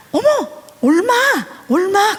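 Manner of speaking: high, loud mock-shocked child; teasing little girl. Daughter squeals at her brother, pitched high and loud.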